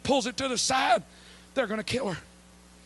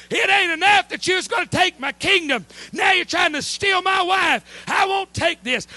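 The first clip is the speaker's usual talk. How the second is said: furious king; 7 semitones higher, 7 dB louder than usual. Acted. King finds Haman on his wife.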